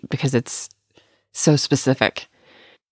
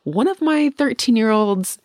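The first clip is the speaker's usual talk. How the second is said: raised, mock-indignant stepmom voice; quoting a friend; 9 semitones higher than usual. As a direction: quote the friend with a lifted voice. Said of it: "and she's like".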